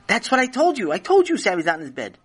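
high, insistent retort; exasperated. A narrator's reading. Wife to husband who only now believes her, exasperated. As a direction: insist you already said it.

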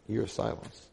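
quiet repeat leaning on "year"; soft echo. Shown as a demonstration. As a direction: let "year" sink into a hushed aside.

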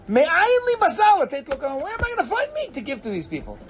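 high, indignant questioning; aggrieved, high-pitched plea; exasperated complaint. Acted. Moses' complaint about meat, acted.

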